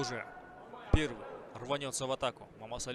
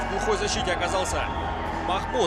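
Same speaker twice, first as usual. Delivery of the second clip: higher, louder fast-breaking play-by-play; excited commentary; 7 semitones higher, 11 dB louder than usual. Real, not acted. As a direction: erupt at the knockout. Commentator reacts to sudden knockout.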